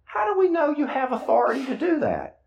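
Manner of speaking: high, doubting challenge; skeptical crowd. Retold or imitated. Crowd questioning Jesus, a hint of sceptical challenge. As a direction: question his right to forgive. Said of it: "And people say".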